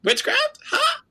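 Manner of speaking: very high, squeaky mock disbelief. Podcaster imitating Caribbean parents hearing of witchcraft, shocked disbelief. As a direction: break each startled question off separately. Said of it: "So they'd be like".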